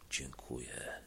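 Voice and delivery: soft, exhausted whisper. A narrator's reading. Frozen driver whispering to the sceptre, soft and spent. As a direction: barely breathe it out. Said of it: "szepnął".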